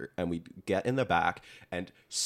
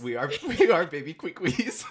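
laughing; 10 semitones higher, 6 dB louder than usual. Real, not acted.

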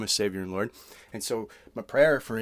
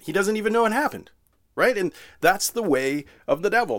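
rising to a loud, high-pitched peak on know; emphatic preaching; 7 semitones higher, 5 dB louder than usual. Real, not acted. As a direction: preach it with mounting outrage. Preacher rails against lying accusers.

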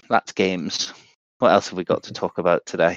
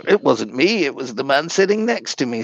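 silly put-on voice; 6 semitones higher than usual. Joking it was someone else.

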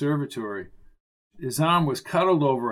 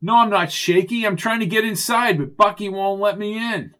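higher, louder protest; raised, exasperated voice; irritated retort; 6 semitones higher, 4 dB louder than usual. Acted. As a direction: snap back at a teasing remark. Irritated retort to Arnie's teasing.

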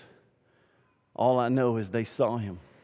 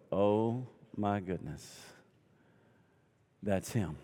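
hushed awe; 5 semitones lower, 8 dB quieter, slower than usual. Retold or imitated. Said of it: "they all of a sudden go".

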